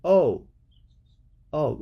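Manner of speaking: ordinary delivery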